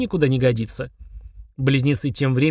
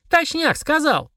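put-on high, drawn-out girlish voice; little girl chiming in; child's voice; slower than usual. A narrator's reading. Girl backing up her brother, high child voice. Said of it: "подтвердила Полина".

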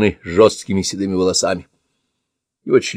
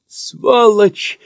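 high, choked cry of rage; choking curse; 13 semitones higher, 3 dB louder than usual. Acted. Old man choking on a curse at his attacker. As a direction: spit the curse out while choking. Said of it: "захлёбывается он".

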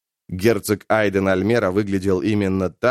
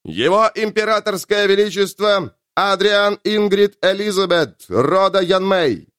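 booming herald's announcement; town crier; 10 semitones higher, 3 dB louder than usual. Acted. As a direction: proclaim it to the whole hall. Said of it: "Зычный голос Герольда прогремел".

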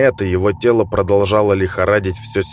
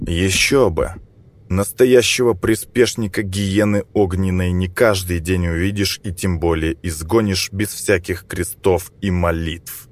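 ordinary delivery

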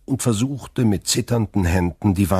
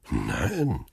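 drawn-out, lower, astonished no; 4 semitones lower, 4 dB quieter than usual. A narrator's reading. Shipowner answers Danglars about the letter, astonished. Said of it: "erwiderte der Reeder erstaunt".

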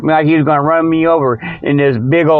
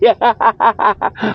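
higher-pitched run of laughter; hearty cackle; 5 semitones higher than usual. Real, not acted. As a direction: crack up at your own joke. Laughs at his own joke.

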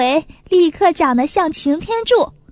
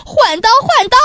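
loud, higher, urgent repeated yell; repeated shout; 7 semitones higher, 5 dB louder than usual. A narrator's reading. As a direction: bark the order twice, pitch climbing. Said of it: "大官连声大喊". (连声大喊)

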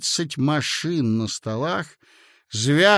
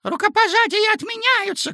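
very high, rooster-like crow; secretary crowing out the decree; 4 dB louder than usual. A narrator's reading. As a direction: crow the announcement. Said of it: "кукарекнул секретарь".

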